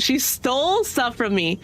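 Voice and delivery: ordinary talk, nothing marked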